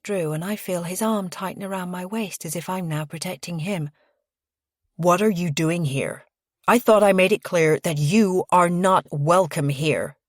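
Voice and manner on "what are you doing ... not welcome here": firm, emphatic rebuke in a male character's voice; controlled, forceful displeasure; stern confrontation. A narrator's reading. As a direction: confront the unwelcome visitor, hitting the words. Confronting an unwelcome visitor.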